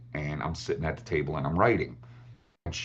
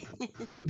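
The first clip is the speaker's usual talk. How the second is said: high breathy laugh; 14 dB quieter than usual. Real, not acted. Laughing at the story.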